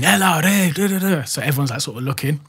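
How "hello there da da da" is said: high, mock shouting; half-hearted high yelling; imitated screaming. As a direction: imitate a commotion of raised voices, pitched high. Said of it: "you hear all this screaming".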